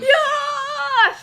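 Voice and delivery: high, loud whoop; excited cheer. Real, not acted. Egging on the reinforcements in the game, loud high cheer. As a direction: cheer it like a rallying cry.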